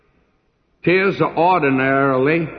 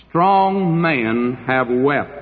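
usual speaking voice

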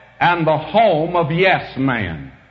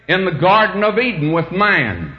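usual speaking voice

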